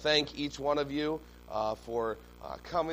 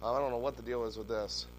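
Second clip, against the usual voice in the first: normal talking voice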